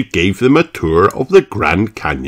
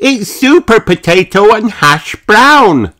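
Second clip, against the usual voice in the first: put-on very high, thrilled child voice; Peppa's excited cry; 14 semitones higher, 4 dB louder than usual. A narrator's reading. Peppa spots two real stars, crying out thrilled. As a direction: cry out as if spotting idols. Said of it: "she cried".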